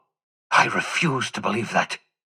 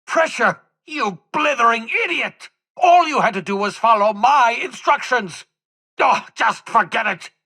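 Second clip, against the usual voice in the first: furious outburst; 10 semitones higher than usual. Acted. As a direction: explode at the fool who ruined everything. Scientist explodes at blundering ally.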